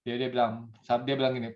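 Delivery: normal talking voice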